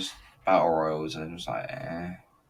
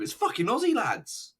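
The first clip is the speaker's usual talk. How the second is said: excited; 14 semitones higher than usual. Real, not acted. Thrilled fan spots [name] at WrestleMania, high giddy squeal. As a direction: blurt it out like a starstruck fan.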